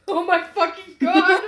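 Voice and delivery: high, loud exclamation through laughter; mock horrified realization. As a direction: imitate a stunned outburst while cracking up. Acts sister's horrified realization, laughing.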